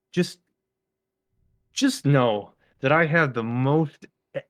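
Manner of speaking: seething rant rising to a shout; slow building fury. Real, not acted. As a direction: spell out your outrage word by word. Building angry rant about parking.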